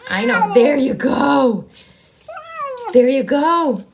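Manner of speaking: loud, slow, urgent encouragement; excited urging. Real, not acted. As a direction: urge her on loudly. Kitten coming out.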